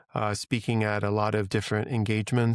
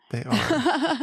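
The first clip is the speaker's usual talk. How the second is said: a heartfelt, much higher second "They are."; high emphatic agreement. Real, not acted. Agreeing, then repeating.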